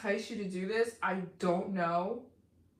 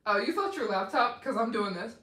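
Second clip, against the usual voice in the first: ordinary talk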